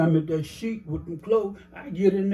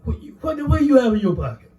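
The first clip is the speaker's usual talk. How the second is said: surprised; 8 semitones higher, 4 dB louder, faster than usual. Retold or imitated. Surprised at the roll of money.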